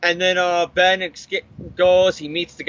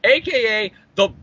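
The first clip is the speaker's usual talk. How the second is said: ordinary talk, nothing marked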